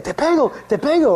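high, swooping mock threat; imitating the kid. Little orphan boy teasing his visitor, imitated kid threat. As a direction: chant it like a feisty little boy, pitch way up. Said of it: "he keeps saying".

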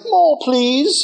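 high-pitched begging orphan voice; pleading orphan. Acted. Orphan in a movie asking for food, pleading. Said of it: "that orphan movie where he's like".

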